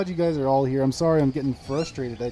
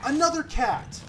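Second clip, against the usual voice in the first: high, dismayed cry; exasperated alarm; 12 semitones higher, 3 dB louder than usual. Real, not acted. Player sees one more cat, exasperated alarm. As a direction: exclaim in real disbelief.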